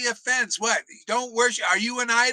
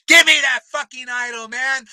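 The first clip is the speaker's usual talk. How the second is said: loud, furious demand; angry shout; 3 semitones higher, 7 dB louder than usual. Real, not acted. Preacher yelling at the gurus to hand over the idol, furious. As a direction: bark it at the guru like a challenge.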